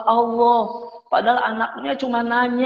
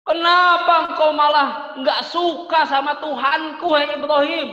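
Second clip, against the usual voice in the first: shouted threat, louder and higher; angry father; 4 semitones higher, 3 dB louder than usual. Acted. Father shouting at his son Ibrahim in anger. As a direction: roar at the son like a furious father.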